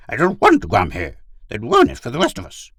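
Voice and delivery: high, loud dog character; defiant talking dog. Acted. Gur refuses his pilot's order, defiant dog.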